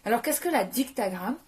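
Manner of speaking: normal talking voice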